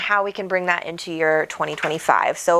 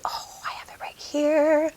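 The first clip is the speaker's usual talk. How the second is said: breathy unvoiced whisper to herself; whispered aside; 6 semitones higher, 5 dB quieter, slower than usual. Real, not acted. Host whispers to herself mid-stream, hushed. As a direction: drop to a whisper while looking.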